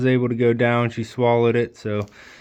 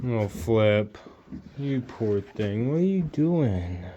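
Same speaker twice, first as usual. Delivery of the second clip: soft and very slow, pitying the poor goat; tender pity; 2 semitones lower, 7 dB quieter, slower than usual. Real, not acted. Owner to her stumbling goat, soft tender pity. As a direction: coo gently over the animal.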